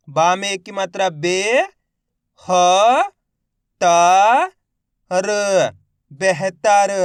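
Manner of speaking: मज़ेदार stretched very slowly and loudly; syllable by syllable demo. Shown as a demonstration. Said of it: "मेरे साथ साथ इनको repeat करना है". (अक्षर-अक्षर जोड़कर धीमे पढ़ना)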